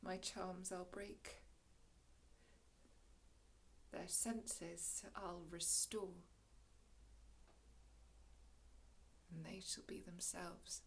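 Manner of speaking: very soft, low, unhurried voice; hushed promise. Acted. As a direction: let it sink almost to a murmur. Prospero quietly promises to free captives.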